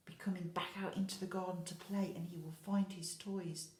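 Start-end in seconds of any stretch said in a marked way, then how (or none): none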